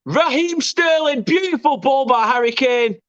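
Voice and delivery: high, thrilled; excited praise. Real, not acted. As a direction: gush about the assist. After third goal.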